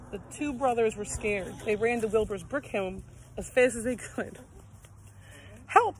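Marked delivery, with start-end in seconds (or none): 5.6-6.0 high, loud cry of help; shouting for help. A narrator's reading. Pigs running to Wilbur's house, panicked high shout. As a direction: yell it in panic. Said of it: "they shouted".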